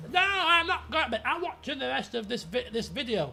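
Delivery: stamp your feet, refuse bedtime; spoiled brat. Retold or imitated. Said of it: "Spoiled little brat".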